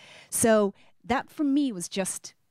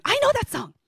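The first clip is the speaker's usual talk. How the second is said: much higher, eager shout of recognition; excited cry; 4 dB louder than usual. Retold or imitated. Colleague grabbing the mic, excited high cry. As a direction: burst out like a fan hearing a favourite.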